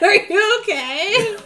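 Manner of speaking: slow, high, loud laughing question; mock concern through a laugh; laughing imitation.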